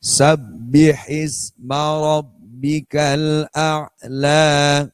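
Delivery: very slow, clear recitation, no melody; slow careful Quran reading for clarity; recitation without melody. Shown as a demonstration. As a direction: recite each word slowly and plainly. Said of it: "Tanpa lagu. Biar langkung jelas".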